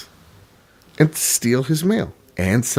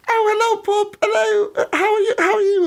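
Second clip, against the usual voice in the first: put-on very high squeaky child voices; kids greeting dad. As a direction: mimic little kids chirping hello to dad. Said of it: "the kids are like".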